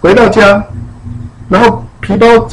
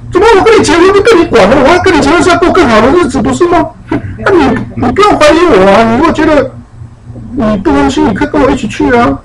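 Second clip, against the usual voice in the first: high, quick, indignant replay; animated husband retort; 7 semitones higher than usual. Retold or imitated. Retelling the husband's indignant excuse with high, quick energy. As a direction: rattle off his self-righteous excuse. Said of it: "他那个才好玩".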